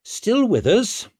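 high, slow pointed question; dash of resentment. A narrator's reading. King asking the old meddler why he remains, resentful. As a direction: ask it slowly with a slight edge. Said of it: "came out with more than a dash of resentment".

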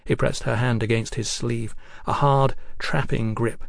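plain reading voice

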